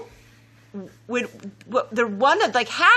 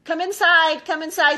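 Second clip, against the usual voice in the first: calling out; 6 semitones higher, 6 dB louder than usual. Real, not acted. Calling child in from outside.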